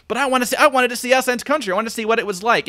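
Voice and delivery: mock wide-eyed pleading to see it all; eager longing. Audience member pleading to go on.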